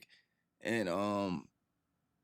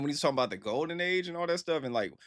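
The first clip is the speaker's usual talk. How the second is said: ordinary talk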